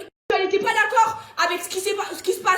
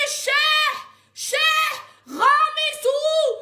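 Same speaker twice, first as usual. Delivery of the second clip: angry yell; 5 semitones higher, much slower than usual. Real, not acted. Angry demand to repay money.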